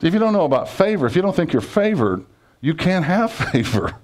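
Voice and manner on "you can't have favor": laughing. Real, not acted. Preacher laughs mid-phrase.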